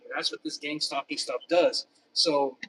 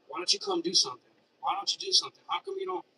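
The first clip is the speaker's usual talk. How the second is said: imitating taunting voices, pitched up; mocking taunt. Mimicking the taunting neighbors, higher mocking voice. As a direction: mimic the harassers' goading, higher.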